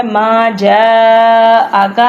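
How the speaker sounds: جَاءَكَ with the long aa after the jeem held, slow; lengthened jeem. Shown as a demonstration. Teacher shows students the madd after the jeem, held long. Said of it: "جیم کو لمبا کرنا ہے".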